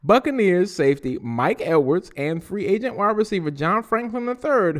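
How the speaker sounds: ordinary talk, nothing marked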